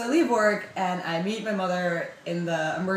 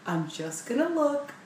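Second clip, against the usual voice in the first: put-on higher, slow coaxing like to a small kid; talking to a child; 6 semitones higher than usual. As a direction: coax her like a scared toddler. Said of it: "talking to me like a child".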